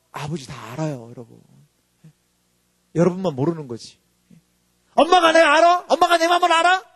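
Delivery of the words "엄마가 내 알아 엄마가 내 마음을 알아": higher, louder resentful daughter; defiant daughter. Retold or imitated. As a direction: fling it at mom like a sulky teen. Defiant child voiced in sermon.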